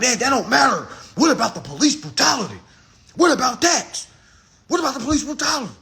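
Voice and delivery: high, loud mimicked protest; insistent comeback. Folks brushing off his point about crime, insistent protest.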